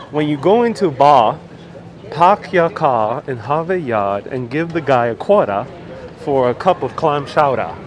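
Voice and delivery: hammed-up Boston accent, r's dropped. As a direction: read it out in a playful Boston accent. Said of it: "you're missing a few letters".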